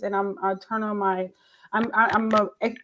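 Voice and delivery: ordinary talk, nothing marked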